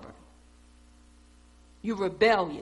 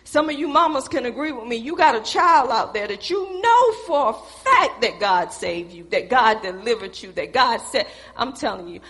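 usual speaking voice

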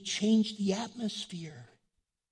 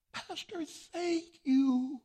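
imitated touched thank-you; grateful nurse; 9 semitones higher, much slower than usual. Voicing grateful nurse after prayer.

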